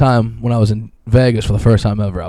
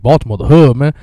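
put-on Baltimore accent; 3 semitones higher, 6 dB louder than usual. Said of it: "he's like".

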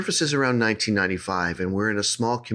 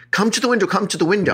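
urgent mother; 10 semitones higher, 3 dB louder, faster than usual. Retold or imitated. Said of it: "her mother shaking her and going".

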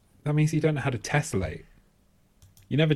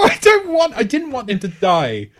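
loud, high-pitched laughing denial; laughing protest; 11 semitones higher, 9 dB louder than usual. Real, not acted. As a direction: protest through a burst of laughter. Laughing protest at teasing accusation.

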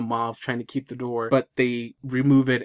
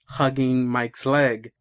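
ordinary delivery

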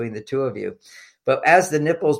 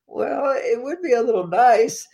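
much higher, timid imitated woman's voice; imitating a bashful wife, pitched far up; meek and shy; 12 semitones higher than usual. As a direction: squeak it out meekly like a shy wife. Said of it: "she very meekly and shyly says".

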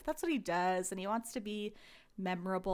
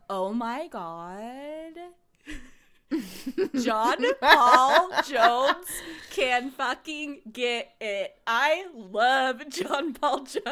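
loud, drawn-out swooning with laughter; gushing; 4 semitones higher, 8 dB louder, much slower than usual. Real, not acted. Co-host raving about a favourite contestant, gushing. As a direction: stretch every word in giddy adoration.

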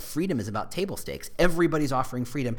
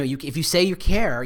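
ordinary talk, nothing marked

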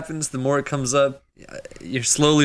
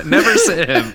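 laughing; 8 semitones higher, 5 dB louder than usual. Real, not acted. Laughing repeat of joke.

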